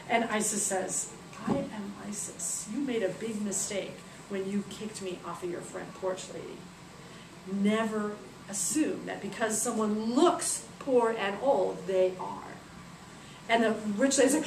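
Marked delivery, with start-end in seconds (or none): 1.5-12.5 slow, quiet stern pronouncement; solemn goddess. Acted. Isis revealing herself to rich lady, solemn reproof. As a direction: pronounce it slowly with calm authority.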